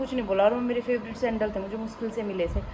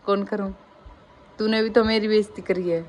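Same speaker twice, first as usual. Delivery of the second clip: usual speaking voice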